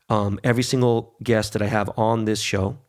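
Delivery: ordinary talk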